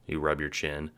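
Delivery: ordinary delivery, nothing marked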